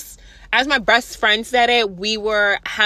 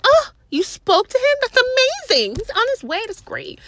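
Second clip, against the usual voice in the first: fake cheerful sarcasm; 13 semitones higher than usual. Her sarcastic reply to manager.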